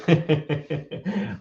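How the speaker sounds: rapid string of soft sniffs; playacted puzzled sniffing; mock sniffing. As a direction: sniff quickly, trying to find the smell. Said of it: "And Michael says".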